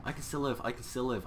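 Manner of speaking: high excited gasping relief; relieved cheering. Real, not acted. Narrow escape relief.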